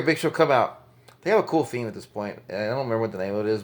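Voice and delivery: usual speaking voice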